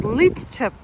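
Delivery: Lidköp- with K turned to ch, "leet-chep"; local pronunciation. Shown as a demonstration. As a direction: break off Lidköp- after "lit-chep". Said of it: "It's pronounced".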